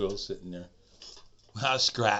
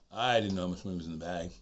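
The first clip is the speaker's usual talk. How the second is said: ordinary delivery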